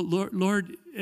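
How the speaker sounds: ordinary delivery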